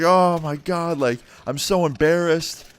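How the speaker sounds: imitated embarrassment. Teacher caught high by her students, imitated embarrassment.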